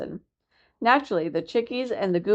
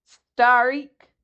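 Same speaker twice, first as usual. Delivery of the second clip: jeering cry; 5 semitones higher, 6 dB louder than usual. A narrator's reading. Gull jeers.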